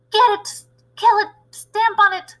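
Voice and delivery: high, loud furious cries; shouting. Acted. Cooks trying to stamp on the mouse, loud high yells. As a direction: bark orders to kill the mouse. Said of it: "they were shouting".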